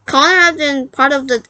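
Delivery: ordinary delivery, nothing marked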